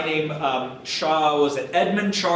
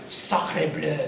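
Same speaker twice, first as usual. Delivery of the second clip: mock French exclamation. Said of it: "And the French were like".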